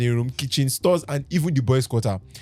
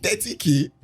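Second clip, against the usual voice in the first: pitched-up disbelieving question; surprised; 7 semitones higher than usual. Acted. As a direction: react to an unbelievable offer. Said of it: "I exclaimed, surprised".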